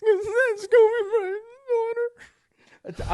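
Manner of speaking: high, wailing cry on the words; mock sobbing. Retelling the theater moment, a hammed-up sob. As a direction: break into an exaggerated sob. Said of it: "if I was gonna say".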